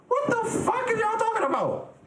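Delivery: hammed-up squeal in a very high pitch; mock outrage. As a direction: screech it in pretend disbelief. Mock outrage at chat's remark.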